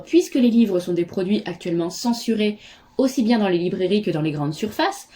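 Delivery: ordinary talk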